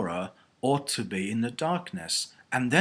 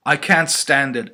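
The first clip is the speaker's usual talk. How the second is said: loud burst of exasperation; anguished protest; 3 semitones higher, 10 dB louder than usual. A narrator's reading. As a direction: protest as if at breaking point. Eleanor's anguished inner protest.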